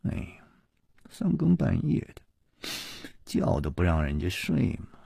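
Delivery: sleepy complaint, softly muttered; muttering. A narrator's reading. Grumpy daughter woken at night, muttering softly. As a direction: grumble it softly under the breath. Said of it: "喃喃地嘀咕着说".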